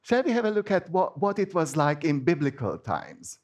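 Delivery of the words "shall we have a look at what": ordinary talk, nothing marked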